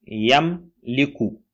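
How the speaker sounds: Yam li ku said very slowly, syllable by syllable; slow model. Shown as a demonstration. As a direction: stretch yam li ku out for learners to copy. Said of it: "répétez après moi".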